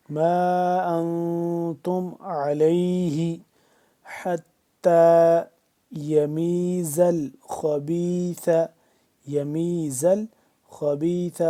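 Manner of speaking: formal Quranic recitation. Shown as a demonstration. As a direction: recite with full tajweed, drawn out. Recites verse slowly.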